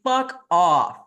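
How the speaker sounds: drawn-out, exasperated; slow disgusted fuck off. Real, not acted. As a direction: drag it out in disgust. Exasperated dismissal.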